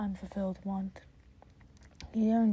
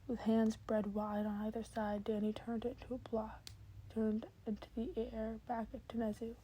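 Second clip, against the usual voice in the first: ordinary delivery, nothing marked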